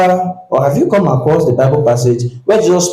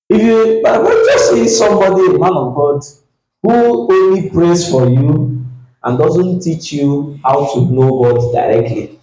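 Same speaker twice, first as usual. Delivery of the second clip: ordinary delivery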